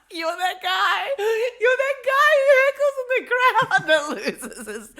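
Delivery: high loud laughing words. Real, not acted. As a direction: talk through big laughter. Laughing hard while teasing.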